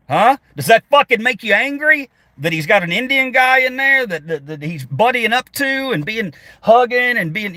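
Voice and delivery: high-pitched sneering taunt; mocking taunt. Real, not acted. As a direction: needle them, voice shooting up. Streamer taunting Trump fans.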